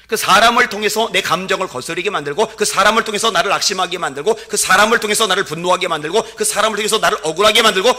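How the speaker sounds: loud, high rising sermon climax; climactic preaching. Acted. Pastor warning the congregation, loud and rising.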